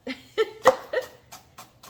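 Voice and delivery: a high breathy burst of laughter; real giggle at her own teary eyes. Real, not acted. Laughs at tearing up from onion.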